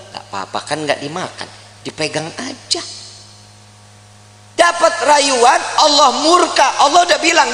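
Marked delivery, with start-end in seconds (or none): 0.1-2.8 soft, low, wheedling reassurance; coaxing. Retold or imitated. Hawa talking Adam into touching the fruit, soft coaxing. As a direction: play it down softly, as if harmless.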